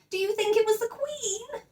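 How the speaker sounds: little bear voice, hopeful and excited. Acted. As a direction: ask breathless with hope. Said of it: "he called excitedly".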